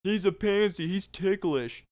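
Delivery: high, jeering taunt; mocking friends. Robber's friends mock him in high jeering voice. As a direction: tease him loudly. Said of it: "He's like".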